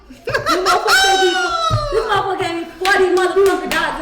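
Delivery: laughing, shouted, high and loud; excited shout. Real, not acted. Girlfriend telling friends about the gift, loud laughing shout.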